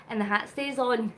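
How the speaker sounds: usual speaking voice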